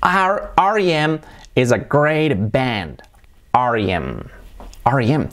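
slow "R E M" as ar-i-em; careful English ar-ee-EM; English letter names. Shown as a demonstration. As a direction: model R E M slowly in English. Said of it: "Se dice así".

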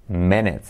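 English Minute, ending in a weak "it"; correct English pronunciation. Shown as a demonstration. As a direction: say Minute as MIN-it, short unstressed second vowel. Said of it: "no minute".

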